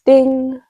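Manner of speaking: bright high "Ding!" like a phone alert; playful mouth sound effect of a phone ding; voiced phone ding. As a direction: sing out the notification chime.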